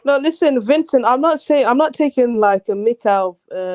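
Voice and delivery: loud, high-pitched objection; insistent protest. Real, not acted. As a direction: push in loudly to clear yourself. Cutting in to defend himself.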